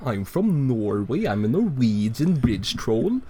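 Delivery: playing a boastful Norwegian bridge troll in a Scandinavian accent. Troll King bragging about Norway, in a hammed-up Scandinavian accent. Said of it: "Scandinavian accent".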